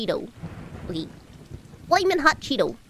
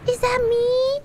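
high, delighted question; high rising excited ask; thrilled surprise; 8 semitones higher, 4 dB louder than usual. Real, not acted. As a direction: squeak it out in delighted disbelief. Spots own cat character.

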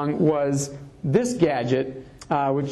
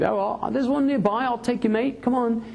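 put-on higher, chummy London accent; cheerful British mate voice; British guy; 8 semitones higher than usual. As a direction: play a friendly English bloke. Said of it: "a guy said".

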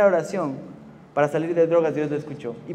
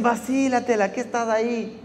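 goading taunt; 6 semitones higher than usual. Retold or imitated. Peers goading quoted.

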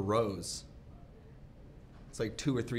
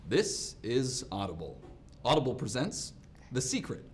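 audiobook narrator. Pretend. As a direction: announce it formally, like the opening of an audiobook. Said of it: "this is what it would sound like".

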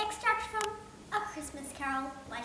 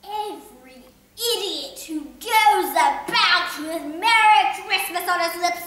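loud, higher furious outburst; indignant; 8 semitones higher, 18 dB louder than usual. Acted. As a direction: erupt in righteous fury.

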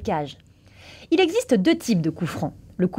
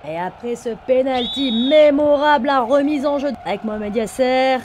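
lively match play-by-play; excited sports commentary; 4 semitones higher, 3 dB louder than usual. Acted. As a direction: narrate the move like TV. Commentary of the free kick.

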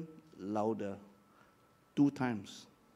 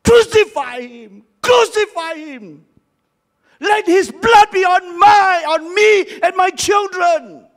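loud, high angry shout; preacher shouting the crowd's words; crying out as the mob; 13 semitones higher, 16 dB louder than usual. Retold or imitated. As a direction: reenact the mob's furious cry. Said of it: "I was crying".

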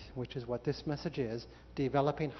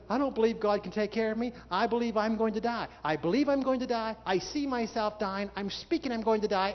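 mock doubting Israelites; 6 semitones higher, 3 dB louder than usual. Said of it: "They said".

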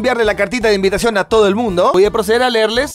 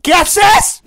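high, loud startled question; scandalized mother bursting in; shocked parent; 6 semitones higher, 4 dB louder than usual. Pretend. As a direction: catch the kid mid-TikTok. Acting parent walking in.

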